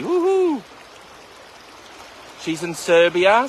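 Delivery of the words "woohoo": a big high whoop of delight; excited woohoo. Real, not acted. Cheering his sister Valerie joining from Serbia, excited whoop. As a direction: cheer her on as she joins.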